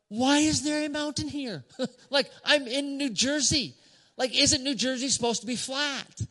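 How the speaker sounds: high, incredulous complaint at a surprise hill; baffled thought. Retold or imitated. Runner griping to himself about a New Jersey hill, high incredulous. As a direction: voice jumps up in mock disbelief. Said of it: "And my first thought was".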